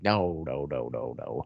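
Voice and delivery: a hint of Jeff brushing it off, dropping low and soft; Jeff's flat denial. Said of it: "And Jeff was like".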